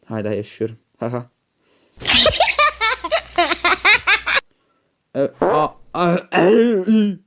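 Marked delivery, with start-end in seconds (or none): 2.0-4.4 loud high burst of laughter, rising; big laugh. Real, not acted. Player laughing out loud at the game, loud burst of laughter. 5.2-7.2 vowels climbing higher and louder; rising vowel cries. Real, not acted. Gamer cries out vowels mid-jump, rising louder. Vocalizing vowels while playing.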